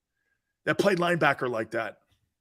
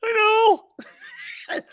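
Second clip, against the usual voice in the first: loud, high fake sob; mock wail; 4 dB louder than usual. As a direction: blurt it in a squeaky bawl. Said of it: "I'm crying over Robert Quinn".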